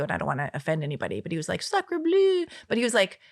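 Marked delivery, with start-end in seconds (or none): 1.6-2.4 hammed-up French accent, much higher and louder; mock French accent. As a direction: toss it off in a cartoon French accent. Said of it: "in a French accent that I can't do".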